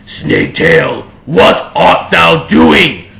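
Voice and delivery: slow, raspy, loud villain call; slow menacing dark-lord call. A narrator's reading. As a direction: intone it like an ancient evil lord. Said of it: "called Voldemort".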